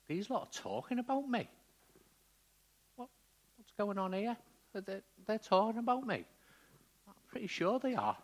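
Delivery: high-pitched, puzzled blind man overhearing gossip; blind beggar. Acted. Blind man realising a crowd talks about him, high puzzled voice. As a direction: act out the blind man's rising suspicion. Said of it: "imagine being that blind man".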